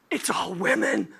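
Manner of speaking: slow, confiding whisper; stunned whisper. Retold or imitated. Man confiding to his friend about new colleagues, stunned whisper. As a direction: whisper it like a shocking secret.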